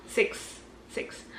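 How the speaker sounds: drawn-out heading fading into whispered echoes; mock echo. Teacher announcing the next myth to viewers, mock fading echo.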